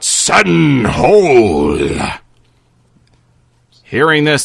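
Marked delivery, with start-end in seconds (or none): 0.0-1.8 cried-out spell. Acted. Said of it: "cries out".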